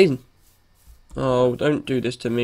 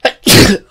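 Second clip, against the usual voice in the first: sneeze; 8 semitones higher, 10 dB louder than usual. Real, not acted. Repeated sneezing fit.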